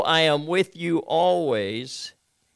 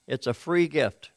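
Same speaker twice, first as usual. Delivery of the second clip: ordinary talk, nothing marked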